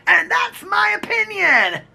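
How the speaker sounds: high, slow mock shout; catchphrase shout. Host capping the channel intro, high shouted catchphrase. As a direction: call it out high and drawn-out.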